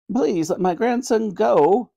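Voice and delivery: high, drawn-out granny plea; pleading granny. Acted. Granny begging the Queen for her grandson, pleading. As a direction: implore as an old grandmother.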